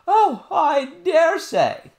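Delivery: high, loud, very slow nurse voice; dismissive nurse. Acted. Nurse dismissing the princess's story, loud and slow. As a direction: brush the child off in a high drawn-out voice.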